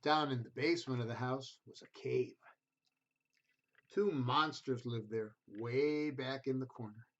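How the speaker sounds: gentle storybook reading voice; reading to children. A narrator's reading. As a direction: read it like a bedtime picture book. Reads picture book to children.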